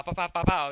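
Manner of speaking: loud rapid punch noises; imitated fight sounds.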